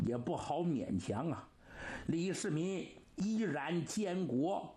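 usual speaking voice

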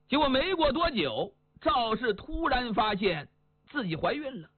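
plain reading voice

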